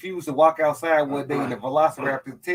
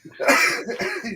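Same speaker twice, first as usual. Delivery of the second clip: laughing; 13 semitones higher than usual. Real, not acted. Laughing at the Sinbad joke.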